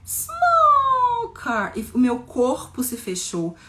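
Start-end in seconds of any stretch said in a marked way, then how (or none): 0.0-1.7 high, drawn-out squeak for smallness; slow, high, itty-bitty voice; thinned tiny voice. Shown as a demonstration. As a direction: shrink the voice to sound teeny tiny. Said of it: "a minha voz se afinou".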